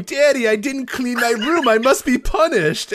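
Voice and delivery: put-on high, slow, whiny childlike plea; mock little girl voice. Retelling an Alexa roleplay tweet as a whiny child. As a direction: whimper it like a naughty child. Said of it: "Alexa, you know, upset, going".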